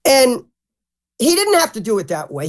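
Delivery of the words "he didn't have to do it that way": normal talking voice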